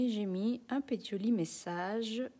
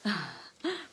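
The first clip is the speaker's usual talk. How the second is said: short, real laugh; amused laugh. Real, not acted. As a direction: laugh at a comment about your accent. Amused by comment on her accent.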